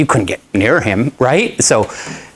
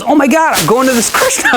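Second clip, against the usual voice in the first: much higher, laughing through it; mock an excited friend while laughing; 13 semitones higher than usual. Friend invites him, teller laughing.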